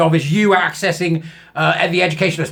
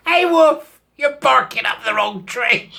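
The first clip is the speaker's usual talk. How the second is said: mocking call-out; 5 semitones higher, slower than usual. Real, not acted. Heckling the student directly.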